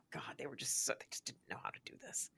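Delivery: whispered aside; whispering. Retold or imitated. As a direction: hush it like gossip. Mocking aside about old coworker.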